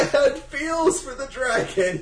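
high, drawn-out plea through laughter; mock-wounded, laughing whine; laughing defence. Real, not acted. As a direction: protest your feelings while cracking up. Mock-hurt defence while laughing.

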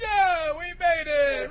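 very high, loud mock celebration; hammed-up victory yell; triumphant cheer. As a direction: cheer as if you just reached the top. Acted cheer on reaching the top.

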